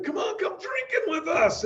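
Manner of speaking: high, pleading party buddy; pushy buddy. Retold or imitated. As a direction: coax a friend to drink. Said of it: "sometimes your buddy's like".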